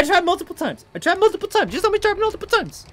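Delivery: very high-pitched complaint; climbing, exasperated high voice; frustrated protest. Real, not acted. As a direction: protest way up high, not shouting. Frustrated after repeated failed tries.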